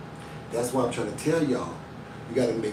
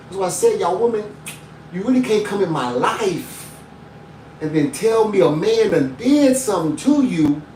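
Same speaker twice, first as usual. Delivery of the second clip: rising pulpit preaching, pushing higher; 3 semitones higher, 4 dB louder than usual. Acted. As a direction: preach it, building the fire. Preaching build about protecting.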